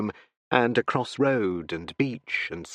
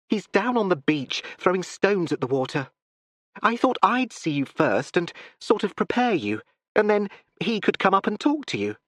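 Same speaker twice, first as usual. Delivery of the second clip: lightly higher, hurried explaining; eager daughter; 7 semitones higher than usual. A narrator's reading. Daughter preparing her father, eager. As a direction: coax him gently toward meeting.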